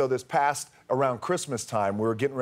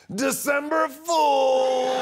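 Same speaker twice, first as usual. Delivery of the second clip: stretched, much higher, louder shout of December Fool; triumphant prank reveal; 13 semitones higher, 3 dB louder, much slower than usual. Retold or imitated. To his agent after the fake scandal, a gleeful drawn-out shout. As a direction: sing it out long and gleeful like a game-show reveal. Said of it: "I was like".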